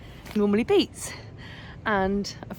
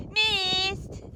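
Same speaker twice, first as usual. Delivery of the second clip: gleeful taunt; 11 semitones higher, much slower than usual. Real, not acted. Dodging thrown maize cobs.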